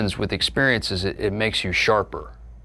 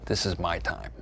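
quiet inner resolve; 4 semitones lower, 9 dB quieter than usual. Retold or imitated. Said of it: "you go".

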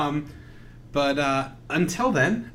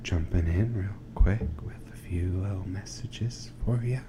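ASMR whisper; 5 semitones lower than usual. Pretend. ASMR parody interstitial.

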